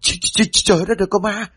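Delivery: high stammering shriek of terror; terrified scream. Acted. Bà Nguyệt sees the hanged body, stammering terrified high scream. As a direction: stutter then shriek at the ghost. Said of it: "Hoảng quá bà liền hét lên".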